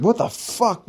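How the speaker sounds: high incredulous outburst; lightly voiced disbelieving sergeant. Staff sergeant sees them walk in, incredulous. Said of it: "Staff Sergeant Aquino was like".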